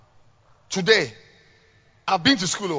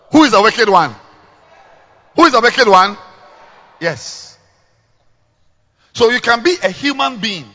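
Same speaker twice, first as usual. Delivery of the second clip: loud, high fiery preaching; preaching at full force; 4 semitones higher, 11 dB louder than usual. Acted. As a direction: thunder it from the pulpit.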